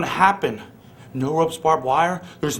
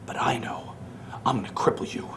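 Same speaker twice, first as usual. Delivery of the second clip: much softer and lower, hissed threat; menacing whisper; 7 semitones lower, 10 dB quieter than usual. Acted. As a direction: whisper it like a promise of pain. Threat to his rival.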